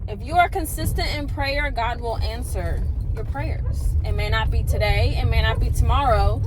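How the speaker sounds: loud, high preacher cadence, hammering the refrain; preaching. Acted. Delivering the day's message to viewers, loud, high-pitched preaching. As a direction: preach it like a pulpit sermon. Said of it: "my little ted Talk".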